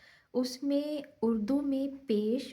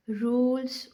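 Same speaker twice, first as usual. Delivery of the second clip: "Rules" said louder with its pitch going up; rising word; 2 semitones higher, 4 dB louder, slower than usual. Shown as a demonstration. Teacher isolating the word for students, loud and rising. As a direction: lift and stress "rules". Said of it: "ऊपर चढ़ रही है हमारी आवाज़".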